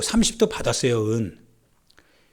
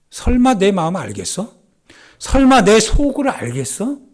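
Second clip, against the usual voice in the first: higher, louder acted inner voice, building; scheming self-assurance; 6 semitones higher, 6 dB louder than usual. Preacher acting Judas's hidden thought before Jesus, smugly incredulous. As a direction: voice a schemer's private confidence, rising in pitch.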